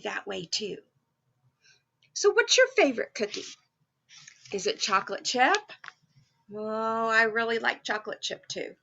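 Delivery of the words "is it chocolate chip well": plain reading voice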